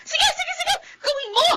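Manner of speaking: high-pitched tearful yell of defiance; crying shout. Acted. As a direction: dare him through tears, voice cracking high. Wife dares husband to hit her.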